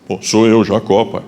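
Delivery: son's slightly deepened disguise voice; disguised voice. Acted. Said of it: "mudou a entonação de voz".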